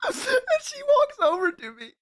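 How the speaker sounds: high-pitched, struggling to speak through laughter; laughing through words. Real, not acted. Streamer tells chat about the cat girl's approach, laughing through words. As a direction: push the words out through a real laughing fit. Laughing while telling the story.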